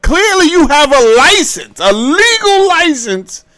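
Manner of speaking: calling him out. Acted. Scolding the defendant for having a license, loud and high. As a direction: shout it at the defendant.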